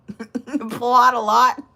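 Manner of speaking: loud, breaking into giggles; cracking up mid-question; laughing. Real, not acted. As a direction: say it through rising laughter. Cracking up at a name.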